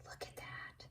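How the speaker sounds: awed whisper. Real, not acted. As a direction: drop to a breathy whisper in awe. Repeats the phrase in a whisper.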